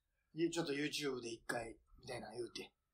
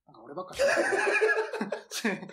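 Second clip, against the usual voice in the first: laughing; 8 dB louder, faster than usual. Real, not acted. Laughing at one-sided chat.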